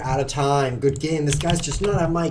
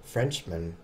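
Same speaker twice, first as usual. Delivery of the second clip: ordinary talk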